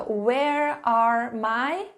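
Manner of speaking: "Where are my" stretched out very slowly with a rising end; slow teacher prompt with a rise; slow dictation. Shown as a demonstration. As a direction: draw out each word for students. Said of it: "Escrevam aqui a pergunta".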